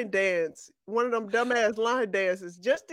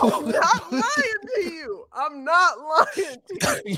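laughing; 4 semitones higher, slower than usual. Real, not acted. Insisting while laughing.

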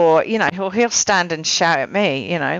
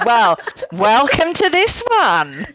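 slow, laughing, a bit higher; amused slow welcome; 6 semitones higher, slower than usual. Real, not acted. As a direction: welcome her while laughing.

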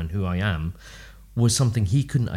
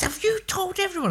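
frustrated mum. Retold or imitated. Imitating his frustrated mother.

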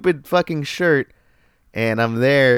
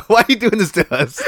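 laughing protest; 6 semitones higher than usual. Real, not acted. Laughing at coworker exposing him.

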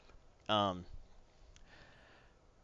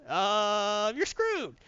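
much higher squeak of doom; squeaky doom; 6 semitones higher, much slower than usual. Retold or imitated. Normie telling the heroes they're doomed, squeaky. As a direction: squeal the bad news. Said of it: "Oh, it absorbed all these powers".

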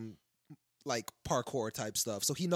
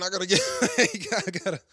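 words broken up by laughter, higher and louder; laughing; 9 semitones higher, 8 dB louder than usual. Real, not acted. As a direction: laugh through the words as you try to talk. Laughing while trying to speak.

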